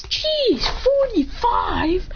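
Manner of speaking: high, drawn-out disbelief; astonished, high-pitched echo; incredulous. Acted. Acted shock at the age.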